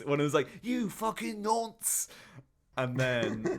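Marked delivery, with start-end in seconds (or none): 0.6-2.1 high, drawn-out mock yell; mock shouted insult. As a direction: hurl it slowly in a high put-on voice. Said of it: "like, shouting at him".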